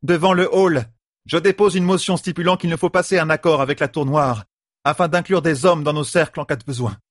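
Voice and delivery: high-pitched formal proclamation. A narrator's reading. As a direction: declare the motion to the whole assembly. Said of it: "et haussa encore le ton".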